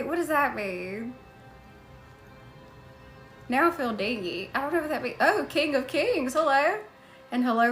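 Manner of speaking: normal talking voice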